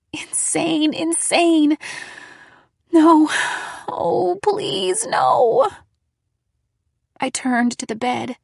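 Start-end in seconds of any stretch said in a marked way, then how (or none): 0.1-1.8 rising panic. A narrator's reading. Panic over hallucinating. 2.9-5.7 high, pleading, rising into a wail; desperate pleading. A narrator's reading. Narrator begs, seeing the demon again, panicked.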